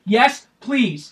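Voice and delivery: loud, high, emphatic begging; acted eagerness. Teen offered a fight by the dad, re-enacted loud eagerness.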